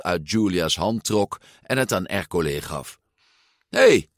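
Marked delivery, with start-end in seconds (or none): 3.7-4.1 high, loud shout of protest; calling out. A narrator's reading. Giulia sees her money taken, calling out. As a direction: shout to stop someone. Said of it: "riep Giulia".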